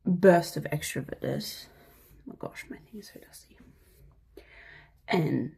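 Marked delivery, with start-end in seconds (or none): 2.3-3.6 whispered aside. Real, not acted.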